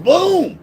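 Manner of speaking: loud, very high "boom"; imitated impact boom. Storyteller mimicking the left-hand hit, loud high boom.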